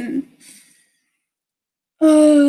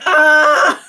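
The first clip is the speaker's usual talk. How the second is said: short loud "ah" yell; sudden loud outburst, replayed; imitated scream; 7 dB louder than usual. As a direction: act out an involuntary scream. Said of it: "my body was just like".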